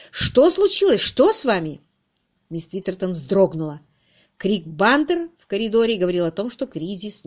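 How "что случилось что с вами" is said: high, loud, alarmed questions; bewildered. A narrator's reading. Harriet to sobbing guest, bewildered. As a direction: demand to know what is wrong. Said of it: "воскликнула совершенно сбитая с толку Харриет".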